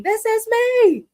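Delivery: high, loud, drawn-out mock holler; mock scream. Co-host imitating how Kent comes across, mock scream. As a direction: stretch it out as a high, showy yell. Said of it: "It's almost like he's screaming".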